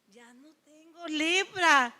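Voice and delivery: gasp, then slow hushed disbelief; acted stunned amazement. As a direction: act out a slow, astonished discovery. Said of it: "se queda así".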